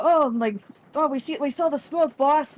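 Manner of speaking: a hint of an eager lackey reporting in; eager water elemental. Water elemental reporting the smoke to their boss. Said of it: "They go".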